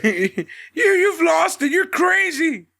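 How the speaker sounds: high, shouted, half-laughing outrage; mock furious yell. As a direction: yell it at your ex-friend in disbelief. Voicing Cole yelling at Kyle.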